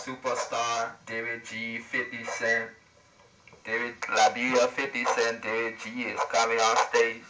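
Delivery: loud stage announcement through walkie-talkie; announcer voice over radio. Pretend. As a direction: hype the star's entrance.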